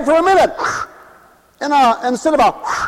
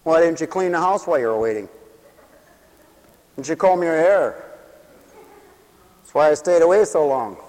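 dry put-down; 7 semitones lower, 4 dB quieter than usual. Acted. The other friend's dry reply.

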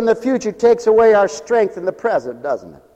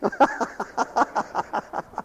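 breathy laugh; 5 semitones higher, 11 dB quieter than usual. Real, not acted. Laughing at own joke.

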